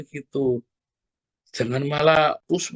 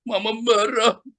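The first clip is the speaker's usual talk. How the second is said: high-pitched mock child tantrum; put-on upset little-kid voice; imitating tantruming child; 8 semitones higher, slower than usual. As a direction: mimic a child protesting when told off. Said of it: "kalau lagi dikasih tahu".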